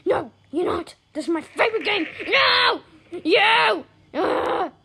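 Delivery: high, loud outburst of protest; mad yelling. Real, not acted. Player protesting over the favorite game, angry high yelling. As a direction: build to indignant yells. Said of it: "I'm mad at you".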